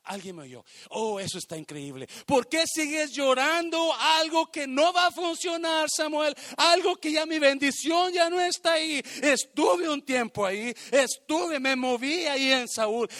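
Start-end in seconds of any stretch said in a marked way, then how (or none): 2.2-13.1 loud, high-pitched preaching cry; full-voiced, insistent challenge; God's forceful rebuke. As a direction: hammer it out as God confronting the prophet. God confronts Samuel, acted.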